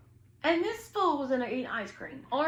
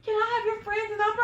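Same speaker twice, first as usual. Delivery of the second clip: much higher, put-on cheeky voice; mocking the guy; 11 semitones higher, 4 dB louder than usual. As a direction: ham up his shameless request. Orange asking for friend's number.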